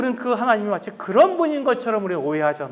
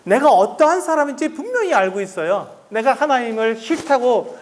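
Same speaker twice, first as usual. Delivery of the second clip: usual speaking voice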